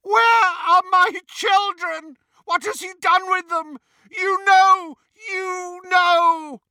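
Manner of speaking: high-pitched, loud accusing wail; cracked, distraught scream. A narrator's reading. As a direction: scream it out as a frantic bereft parent. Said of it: "His voice was cracked, high-pitched. He sounded distraught".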